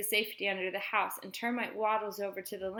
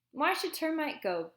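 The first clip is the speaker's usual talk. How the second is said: high-pitched indignant challenge; arguing; 7 semitones higher than usual. A narrator's reading. Boy defending his dog to his mother, arguing. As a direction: argue back like a child. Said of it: "Beans argues".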